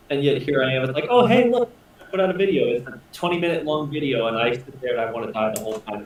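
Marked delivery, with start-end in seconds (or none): 1.1-2.7 mock cheerful. Mock-cheerful self quote.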